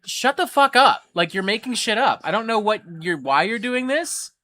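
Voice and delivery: snapping back, fed up; retold outburst. Telling off an online arguer, loud and exasperated. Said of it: "And I was just like".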